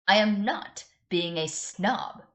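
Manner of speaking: loud, slow, indignant snap; snapping. Acted. Elphaba snapping at Glinda over being called a snob. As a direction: bite back hard at the accusation. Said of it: "Elphaba snapped".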